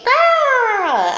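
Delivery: high, loud bird squawk; gleeful bird shriek. Acted. Bird flying on the broom, high gleeful shriek. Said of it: "The bird shrieked with glee".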